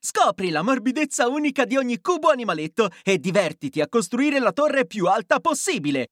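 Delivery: ordinary talk, nothing marked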